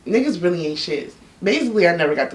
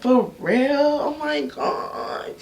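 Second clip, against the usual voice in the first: mock surprise; 8 semitones higher, much slower than usual. Answering the front desk girl's gossip, mock surprised. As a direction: act stunned, drawing it out.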